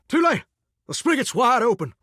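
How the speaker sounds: high, sudden Southern whoop; sudden excited exclamation. Acted. Shower announces its spigot is open, high and excited.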